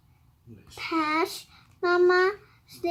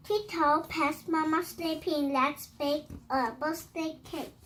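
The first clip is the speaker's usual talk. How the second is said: ordinary delivery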